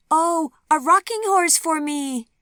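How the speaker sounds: put-on high, loud, slow child's cry of joy; loud, high delighted child; small shout. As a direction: shout it like a thrilled little girl. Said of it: "came a small shout".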